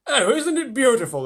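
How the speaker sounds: high, loud delighted exclamation; cried out. A narrator's reading. Friends atop the flying peach, delighted cry. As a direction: exclaim with wonder and joy. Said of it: "they cried".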